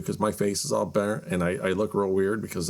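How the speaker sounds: normal talking voice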